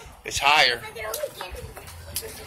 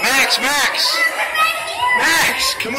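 calling out; 5 dB louder, slower than usual. Real, not acted. Dad calls lost son Max.

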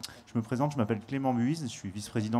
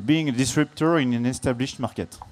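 English title, said louder in a playful put-on English accent; self-mocking English accent; 2 semitones higher, 5 dB louder than usual. Shown as a demonstration. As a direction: show off your English accent with a wink. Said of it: "mon superbe accent, euh, anglais". (titre lancé avec un accent anglais appuyé, pour rire)

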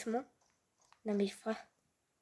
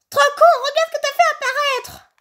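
character voice; 7 semitones higher, faster than usual. Pretend. Voicing a game character insulting.

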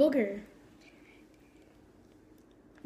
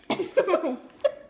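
high giggle; 4 semitones higher than usual. Real, not acted. Laughing fit after gagging.

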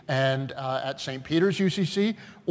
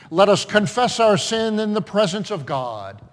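projected call to confession, falling at the close; liturgical call; 4 dB louder than usual. Real, not acted. Pastor inviting the congregation to confess sin, liturgical call. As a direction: formally summon the congregation to confess.

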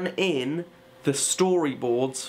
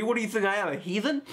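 higher, hammed-up offended retort; mock indignation; 7 semitones higher, faster than usual. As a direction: act affronted, pitch climbing. Mock indignation at obvious question.

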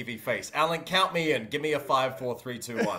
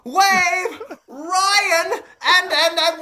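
hype announcer; 7 semitones higher, 6 dB louder, slower than usual. Acted. Announcer-style show intro.

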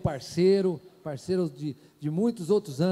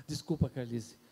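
normal talking voice

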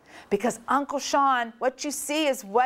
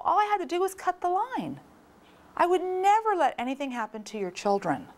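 much higher, pleading excuse; defensive brother; 9 semitones higher than usual. Retold or imitated. Brother defending himself to his sister, high and pleading. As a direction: talk your sister down. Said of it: "He goes".

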